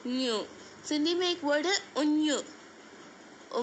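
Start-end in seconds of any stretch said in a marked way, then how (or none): 0.1-0.4 न्यू as a nasal palatal ny glide; sounding out न्यू. Shown as a demonstration. Teacher modelling the Sindhi nasal sound. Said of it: "इसे पढ़ते हैं न्यू".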